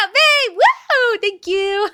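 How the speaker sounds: high, loud whooping; excited cheer. Real, not acted. Streamer cheering after the boyfriend's shout-out, whooping. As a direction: cheer your heart out.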